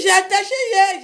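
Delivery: imitating a high, loud retort; hammed-up high-pitched protest; retold protest. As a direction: yell back in mock indignation. Retold protesting reply of the sister.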